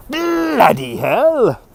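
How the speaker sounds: put-on BLOODY drawn out high in a British accent; very slow, hammed-up British exclamation. Streamer channelling the guy in Threads as the bomb goes off, hammed-up British accent. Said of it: "that is my inspiration for saying".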